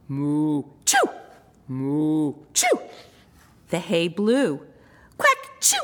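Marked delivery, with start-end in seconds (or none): 0.1-2.8 cow sneeze. A narrator's reading. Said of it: "Cow sneezed". 5.2-5.8 very high quacky duck sneeze; sneezing duck. A narrator's reading. Narrator as a duck, sneezing high. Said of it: "went duck".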